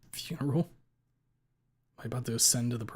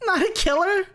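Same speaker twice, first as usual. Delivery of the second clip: high, loud, screaming disbelief; furious disbelief; 11 dB louder than usual. A narrator's reading. Basil screaming at Ripley in outrage. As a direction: scream it back at him. Basil raving after his son's death.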